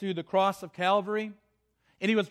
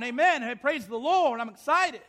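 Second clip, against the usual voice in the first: higher-pitched mock crowd cheer; excited shouting; 4 semitones higher than usual. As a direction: act out an excited worshipper calling out. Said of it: "They're, they're shouting".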